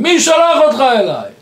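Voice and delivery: high, loud cry of disbelief; yelled demand in a retold story; Israeli-style shout. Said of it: "והוא צועק, כמו הישראלים".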